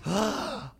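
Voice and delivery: a sharp startled intake of breath; shocked gasp. Shown as a demonstration. Preacher acting out being shocked, sharp gasp. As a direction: gasp as if something just shocked you. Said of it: "what truly just shocks you".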